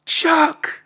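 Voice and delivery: very high, loud squealed call; retold yell. Kid spots rapper backstage, high excited yell. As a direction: re-enact a starstruck kid yelling for an idol. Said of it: "I'm yelling out to him".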